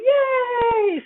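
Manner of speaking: very high, bright yelp of joy; excited dog. Retold or imitated. Teacher voicing the happy dog, a high excited yelp. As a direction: yelp like a thrilled puppy. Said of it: "and the dog goes".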